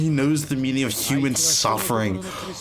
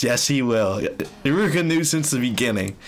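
ordinary delivery

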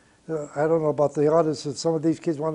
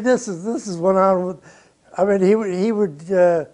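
usual speaking voice

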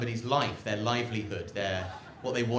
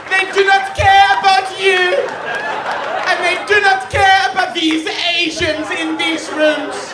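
much higher and far louder, slow impassioned rant; Greta Thunberg impression; 14 dB louder, slower than usual. Greta lecturing the world's leaders, in a slow, high, shouted rant. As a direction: shriek it like a furious young activist at a summit. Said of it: "if she would say".

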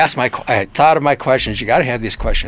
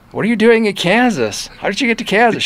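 surprised; 9 semitones higher than usual. Retold or imitated. Baffled reply to "I'm in Kansas.

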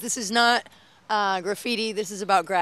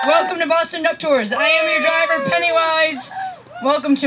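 high, projected showy welcome; big theatrical introduction; tour guide spiel; 6 semitones higher, 6 dB louder than usual. Pretend. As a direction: greet the whole bus like a hammy emcee. Tour driver's performed welcome.

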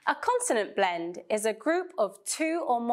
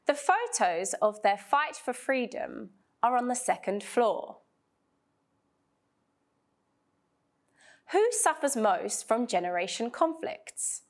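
model sentences. Shown as a demonstration. Teacher reading model sentences for students to repeat. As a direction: read each sentence clearly for repetition. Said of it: "repeat after me".

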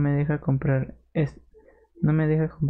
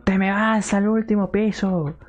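imitating parents; 6 semitones higher than usual. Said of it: "Y mis padres me dijeron".

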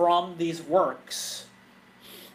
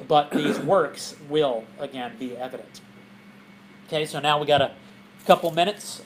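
normal talking voice

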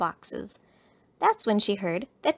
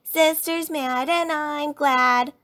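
slow, high, loud playground taunt; sing-song child's jeer; shouted taunt; 7 semitones higher, 6 dB louder, slower than usual. A narrator's reading. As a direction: chant it teasingly after a playmate. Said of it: "shouted Lizzie".

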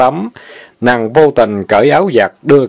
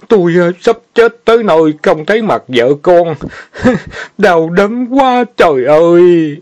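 dying words; 6 semitones higher than usual. Acted. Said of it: "thều thào trăn trối".

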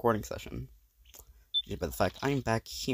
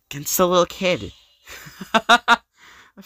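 gloating laugh; 6 semitones higher, 9 dB louder, much slower than usual. Acted. Villain gloating over child.

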